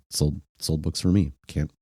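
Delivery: ordinary delivery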